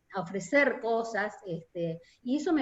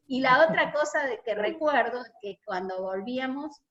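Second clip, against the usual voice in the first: ordinary talk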